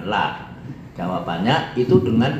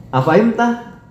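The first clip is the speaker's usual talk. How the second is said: "Afahimta" high, climbing to the end; "Afahimta" as a high-pitched question; rising question intonation; 11 semitones higher, 3 dB louder than usual. Shown as a demonstration. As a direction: ask "Afahimta" with a strong upward lift. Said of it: "intonasinya naik".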